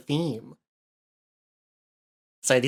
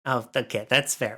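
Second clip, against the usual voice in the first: usual speaking voice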